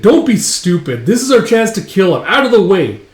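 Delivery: high, loud arguing; acted heated shouting. Bandmate argues to join the duel and kill, heated shout. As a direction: shout down whoever blocks you. Retold quarrel among the band.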